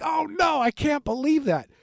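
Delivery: a hint of horror, eyes wide; dismayed disbelief. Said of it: "And I'm like".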